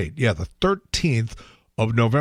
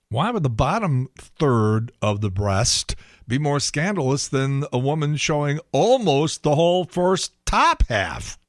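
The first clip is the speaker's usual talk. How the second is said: ordinary talk, nothing marked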